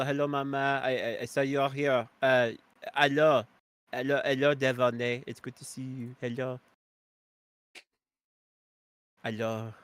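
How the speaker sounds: a lightly put-on French accent; bad French accent. Greeting a chat viewer again, in a fake French accent. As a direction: say hello like a hokey Frenchman. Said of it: "I did it again".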